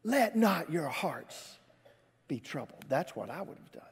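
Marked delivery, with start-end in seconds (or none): 0.0-2.8 slow, pointed reproof, falling away at the end; chastising. Acted. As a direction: draw it out as a stern admonition. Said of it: "I would have kind of chastised him".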